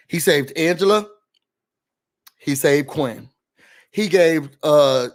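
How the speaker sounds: normal talking voice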